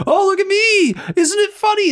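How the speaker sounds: high-pitched sarcastic impression of the husband; mock goofy husband. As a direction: ham up a clueless guy showing off. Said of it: "and he's like".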